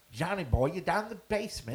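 badly faked Minnesota accent; Minnesota accent attempt. Put on the spot to redo it, shaky-attempt Minnesota accent. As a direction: have a shot at Minnesota accent, pitch climbing. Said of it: "attempt at a Minnesota accent".

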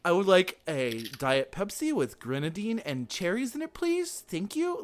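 mock kid voice, climbing very high by the end; slow, over-polite youngster placing an order; teen playing grown-up. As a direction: imitate a teenager trying to sound adult. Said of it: "like a fifteen-year-old trying to act like a grown-up".

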